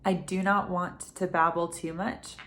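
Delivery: usual speaking voice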